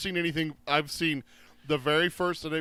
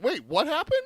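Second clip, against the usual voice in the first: a higher, hammed-up "what happened?"; mock surprise; 11 semitones higher than usual. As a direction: play a friend's baffled double-take, lightly. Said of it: "So it's like".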